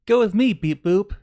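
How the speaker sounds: loud, high, bouncy invitation; playful call-out. Pretend. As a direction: call them along with a silly robot flourish. Playful call, beep boop for fun.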